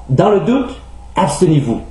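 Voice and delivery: usual speaking voice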